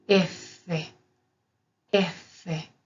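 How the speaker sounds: "F" as effe, holding the double f; double consonant. Shown as a demonstration. Teacher demonstrating the Italian F twice, deliberate double-consonant demo. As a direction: say "F" in two beats, ef-fe. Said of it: "se pronuncia con doble F".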